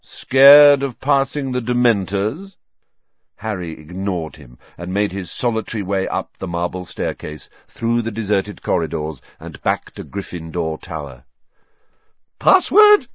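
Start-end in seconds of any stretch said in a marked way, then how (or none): 0.0-2.6 taunting jeer. A narrator's reading. Malfoy taunting Harry. 12.4-13.1 a very high, loud portrait voice jolted from a doze; startled Fat Lady. A narrator's reading. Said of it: "said the fat lady".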